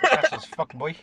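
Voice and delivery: laughing mock echo. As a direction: squeal it while cracking up. Mocking echo while laughing.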